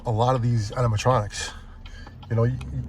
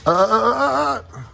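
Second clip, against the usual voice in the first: mocking stammer; 10 semitones higher than usual. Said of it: "They're like".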